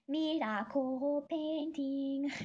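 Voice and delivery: lightly imitating a childlike vocal synth; Kesa Neteto voice. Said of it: "her voice is just like".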